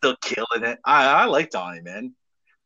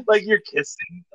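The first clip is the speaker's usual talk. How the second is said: ordinary talk, nothing marked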